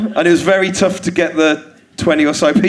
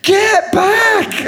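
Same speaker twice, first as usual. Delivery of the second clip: much higher, yelled get back; shouting. Retold or imitated. Comic yelling at the kids rushing forward, a high yell. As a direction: yell to stop a stampede of kids. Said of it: "I was shouting".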